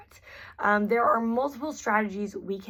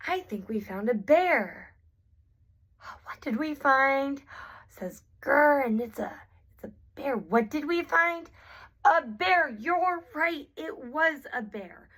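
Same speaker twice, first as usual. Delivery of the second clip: put-on higher, playful therapist-to-child voice; animated child-directed talk; 5 semitones higher than usual. Shown as a demonstration. Therapist modelling a listen-first game for a child, animated and higher. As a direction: talk a young child through a discovery.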